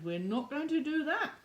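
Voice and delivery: ordinary delivery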